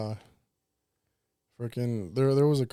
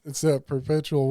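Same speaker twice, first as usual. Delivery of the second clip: normal talking voice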